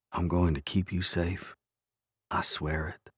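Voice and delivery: very soft, near-whispered promise; silent vow. A narrator's reading. Jonah swears to protect sleeping Rachel, hushed silent vow. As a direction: drop to a hushed murmur, fading into whisper. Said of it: "With that silent vow".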